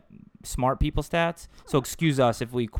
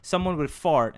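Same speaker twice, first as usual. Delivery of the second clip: normal talking voice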